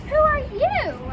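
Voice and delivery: high, loud, slow puzzled question; surprised. Acted. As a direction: ask it baffled and slow. Surprised again at second visitor.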